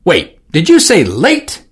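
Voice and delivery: gasped surprise. Acted. Orsino alarmed at Johnson's news. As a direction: catch on the word late. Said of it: "gasped Orsino".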